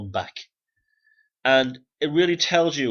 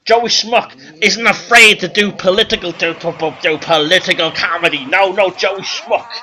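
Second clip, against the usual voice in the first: loud, hammed-up club comic working the crowd; brash stand-up comedian; 8 semitones higher, 11 dB louder than usual. Joey Schmuck playing the Copacabana crowd, brash comic patter. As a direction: belt it out like a hack nightclub act.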